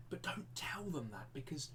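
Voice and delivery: hushed mock-secret aside; conspiratorial whisper. Teacher keeping a secret from his animals, whispering. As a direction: drop to a whisper so they can't overhear.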